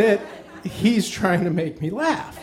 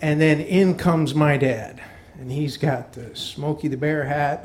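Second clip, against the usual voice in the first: usual speaking voice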